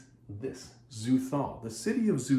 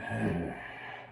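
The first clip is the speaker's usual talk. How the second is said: heavy sigh; 5 semitones lower, 6 dB quieter than usual. Acted. Conan weighing the last water.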